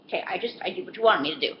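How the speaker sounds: timid protest. Retold or imitated. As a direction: hurry out a nervous excuse. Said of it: "I'm like".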